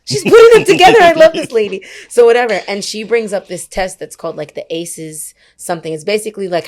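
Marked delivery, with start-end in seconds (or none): 0.0-1.8 high, loud, thrilled gush; gushing high excited fan voice; gushing excitement. Retold or imitated. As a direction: squeal it like you just found your new favourite speaker. Excited quote of herself at session.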